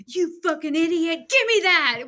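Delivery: high, loud contemptuous snap; harsh self-scolding. Retold or imitated. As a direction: scold herself like a furious parent. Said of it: "Instead of being like".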